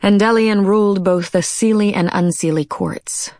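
ordinary delivery, nothing marked

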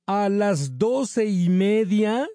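high, slow, disbelieving echo; astonished. A narrator's reading. Kids echoing the clerk's answer in shock, slow and high. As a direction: repeat it stunned, drawn out. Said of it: "repitieron a coro Erika y Alain asombrados".

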